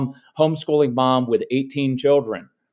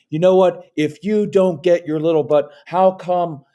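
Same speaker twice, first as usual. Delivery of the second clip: mock shaming scold; 3 semitones higher than usual. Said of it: "All those kind of shaming things".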